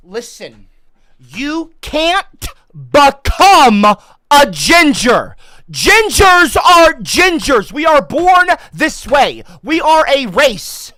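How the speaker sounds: loud, high, slow hammed-up yell; mock outraged shout. Ginger vlogger yells at the article, slow hammed-up shout. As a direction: bellow it like an aggrieved crusader.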